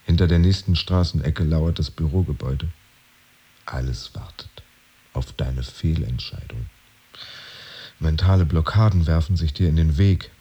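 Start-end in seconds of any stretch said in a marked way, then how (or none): 3.6-6.7 softer hushed whisper. Acted. Narrator whispering as everything waits. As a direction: breathe it out like a held breath.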